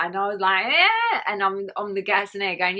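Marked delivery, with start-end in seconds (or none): 0.7-1.2 much higher, louder dazed yeah; a woozy, high yes on gas and air. Retold or imitated. High-on-gas-and-air answer in labour.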